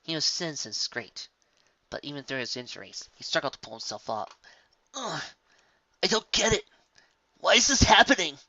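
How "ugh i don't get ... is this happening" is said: pained Spike. A narrator's reading. Injured Spike.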